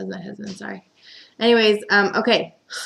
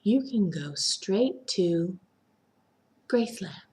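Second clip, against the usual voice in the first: quietly menacing quote; 6 dB quieter than usual. Retold or imitated. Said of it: "Marlene is like".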